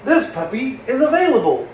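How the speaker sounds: high, swooping happy news; hopeful excitement. Acted. Puppeteer narrating an available puppy, hopeful excitement. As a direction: lift it up with rising hope. Acted good news in the story.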